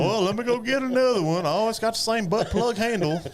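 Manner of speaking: high, chirpy dopey player voice; high goofy voice. Retold or imitated. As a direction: play the clueless batter brightly. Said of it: "He's like".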